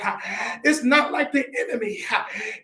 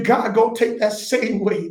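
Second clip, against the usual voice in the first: lower-pitched, contained declaration; measured preaching; 5 semitones lower than usual. Real, not acted. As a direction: deliver the promise firmly, held in reserve. First take of the preached promise.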